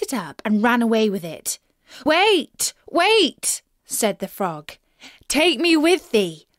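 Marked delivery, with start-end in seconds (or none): 2.0-3.6 high, loud desperate calls; frog screaming. Acted. Frog to fleeing princess, high loud cry to wait. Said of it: "to scream his croak". 5.3-6.5 frog calling after. Acted. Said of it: "croak after her as loudly as he could".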